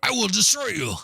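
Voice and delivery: high hammed-up martial arts villain; kung fu movie villain. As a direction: ham up a seventies kung fu baddie. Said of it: "come at me with that kind of voice".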